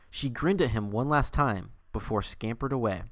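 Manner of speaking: normal talking voice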